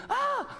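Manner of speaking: echo shout. Retold or imitated. Child shouting to hear the echo.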